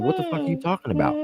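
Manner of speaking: high, fast baffled squeal; mock high disbelief; incredulous squeak. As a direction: play yourself as a shrill bewildered private. Confused reply to sergeant.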